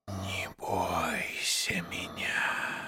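slow, soft hissing whisper; gentle serpent whisper. A narrator's reading. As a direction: coax him softly like a snake. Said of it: "ласково прошипела змея".

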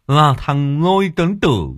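high, loud, slow laughing tease; high chuckling taunt between laughs; cackling mockery. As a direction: laugh at the swollen face. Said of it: "cười lên sằng sặc".

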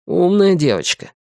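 ordinary delivery

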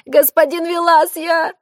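high, panicked plea; frightened pleading. Acted. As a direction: beg in a high, scared voice. Terrified man begs killer.

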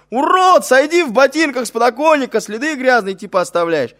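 shrill, very high screeching yell. Retold or imitated. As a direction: screech at him like a furious wife. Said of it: "эта пизда как заорёт".

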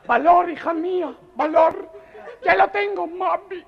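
squeaky falsetto daughter voice; high squeaky voice of the girl in the joke; mother and daughter voices. Acted.

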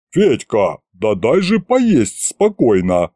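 slow, mildly indignant grumble. A narrator's reading. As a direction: protest slowly at being disturbed during a meal. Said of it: "возмутился Горыныч".

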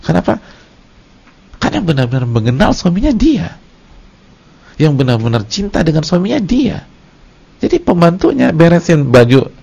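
high, loud sermon voice; full-force preaching. Acted. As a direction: preach it out to the congregation. (ceramah berapi-api dengan suara tinggi)

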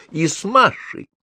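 high, swooping accusatory exclamations; indignant mother, voice leaping up; exclaiming. A narrator's reading. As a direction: pile up the accusation with rising pitch. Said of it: "восклицала мама".

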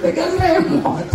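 high laughing jab; high giggling tease; laughing mockery. Real, not acted. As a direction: laugh at the remote-holder. Mocking laugh.

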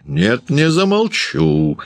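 high, drawn-out malicious refusal; gloating cry. A narrator's reading. Landlady refusing Varvara, gloating high cry. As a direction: crow it with glee, pitched high. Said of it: "злорадно крикнула Ершова".

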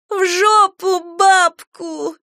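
put-on high, very slow, tearful girl's voice; crying voice. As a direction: sob out the curse through tears. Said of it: "сказала она плачущим голосом".

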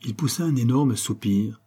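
ordinary delivery

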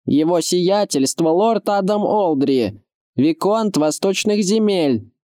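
high, slow herald-like announcement; firm elderly retainer calling up; firm old man. A narrator's reading. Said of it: "твёрдый старческий голос".

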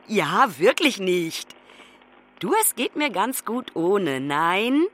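ordinary talk

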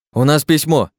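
loud, high shout of у нас письмо with the stress on письмо. A narrator's reading. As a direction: call out urgently while backing away. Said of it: "крикнул Олег".

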